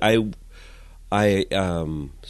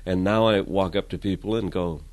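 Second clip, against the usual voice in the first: ordinary talk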